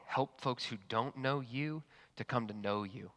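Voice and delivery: hushed pastoral prayer before the sermon. Acted. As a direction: pray it quietly and earnestly. Pastor leads opening prayer.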